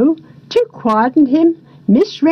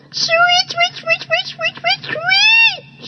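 canary; slower than usual. A narrator's reading. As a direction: chirp it like a canary. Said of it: "said the canaries".

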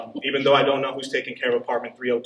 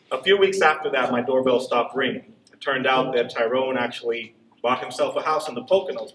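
ordinary talk, nothing marked

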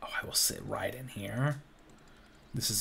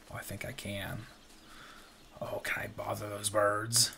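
ordinary talk, nothing marked

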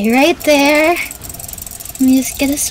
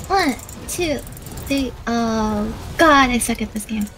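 ordinary talk

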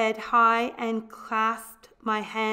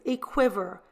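usual speaking voice

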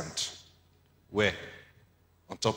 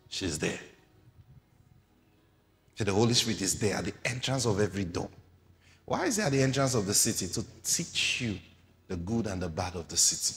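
ordinary talk

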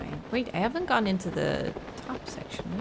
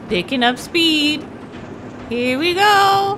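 rising louder and higher; building excitement; 10 semitones higher, 14 dB louder, slower than usual. Real, not acted. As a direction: build hype to a shout. Speeding up, building hype.

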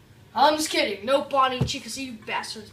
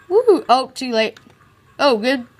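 much louder excited yelps; 3 semitones higher, 13 dB louder than usual. Real, not acted. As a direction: react to the fryer rush. Cooking rush reactions.

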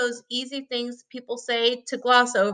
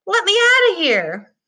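much higher, louder panicked cry; 10 semitones higher, 6 dB louder than usual. Acted. Jack overwhelmed by the thumping rabbit, panicked cry. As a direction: cry out to be let out.

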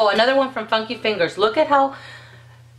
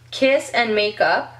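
ordinary talk

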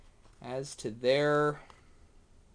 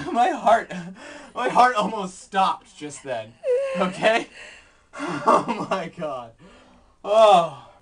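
laughing out the fright, higher and louder; shaky laughter; 6 semitones higher, 9 dB louder, slower than usual. Real, not acted. Vlogger laughing off a spider scare. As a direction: laugh through the pounding heart.

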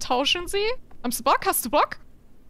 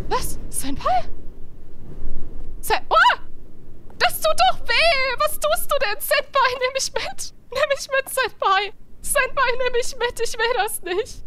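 panicked high voice; 8 semitones higher than usual. Pretend. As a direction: beg in shrill panic. Panicked high voice, being taken.

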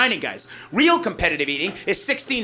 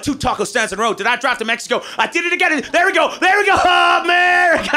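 hillbilly driver; 9 semitones higher, 4 dB louder than usual. Retold or imitated. Hillbilly driver finding his way home by the flags, cheering loudly. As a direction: build into a triumphant hollered America. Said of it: "they're like".

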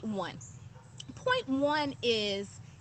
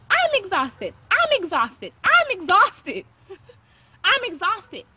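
louder and higher, hammering "I'm exhausted"; repeated loud outburst; 6 semitones higher, 6 dB louder, slower than usual. Real, not acted. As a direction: keep pounding the complaint. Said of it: "aggressive at the same time".

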